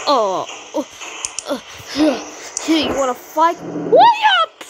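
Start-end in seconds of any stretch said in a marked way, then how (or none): 3.6-4.6 very high, loud what up; a tiny cartoon critter calling out; high squeaky greeting; squeaky character voice.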